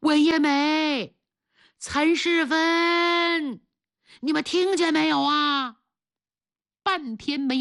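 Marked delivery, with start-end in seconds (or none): 0.0-5.8 slow, high calls that carry far; teacher shouts his students' names across the flood; calling names. A narrator's reading. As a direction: stretch each name out to carry. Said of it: "他大声呼叫学生名字".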